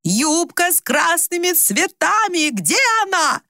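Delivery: very high, drawn-out agitated cry. A narrator's reading. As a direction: pitch soaring, shout without much volume. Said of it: "Мать перешла на крик".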